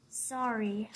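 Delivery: soft, low apology; gentle honesty. Acted. Gerald gently telling Piggy her trumpet is not music, soft and low. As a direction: let the truth down softly. Gerald breaks bad news.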